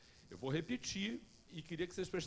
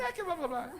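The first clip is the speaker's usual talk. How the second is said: mocking child; 12 semitones higher, 6 dB louder than usual.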